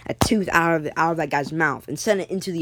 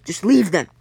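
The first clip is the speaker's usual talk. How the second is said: sharp order; 5 dB louder than usual. Acted. Captain orders them left behind.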